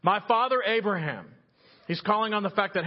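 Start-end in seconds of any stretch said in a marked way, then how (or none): 0.0-1.2 raised, higher call across a distance; mild shout. Acted. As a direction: call out to a far-off father, not at full volume. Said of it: "The rich man shouted".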